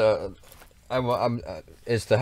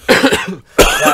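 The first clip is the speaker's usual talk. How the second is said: laughing; 15 dB louder than usual. Real, not acted. Coming off big laugh.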